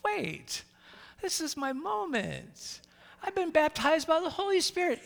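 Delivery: lightly voiced Jesus reflecting; imagined inner thought. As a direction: hush it like a private realisation.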